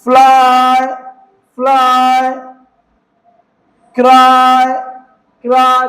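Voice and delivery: ट्राई, माय, फ्लाई, क्राई with long level "aaai" endings. Shown as a demonstration. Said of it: "आई पढ़ते हैं".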